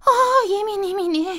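high, loud wail; loud lament. Acted. Squirrel wails loudly, forgetting her birthday. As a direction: cry out in woe. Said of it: "klagte sie laut".

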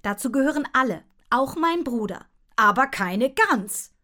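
loud, stubborn dad laying down the law; dad insisting. A narrator's reading. Dad insists to mother, loud and stubborn. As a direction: hammer it home, no arguing. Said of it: "Darauf bestand Papa".

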